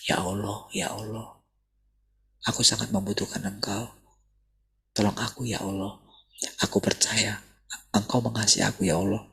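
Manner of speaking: hushed, pleading prayer to God; soft prayer. Shown as a demonstration. Pastor leading a seeker in a soft prayer. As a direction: pray it quietly, leading someone along. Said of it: "coba ikuti doa saya".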